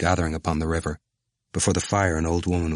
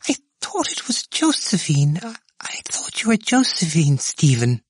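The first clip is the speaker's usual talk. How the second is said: high, half-whispered frail voice; feeble whining woman; 12 semitones higher, 3 dB louder than usual. A narrator's reading.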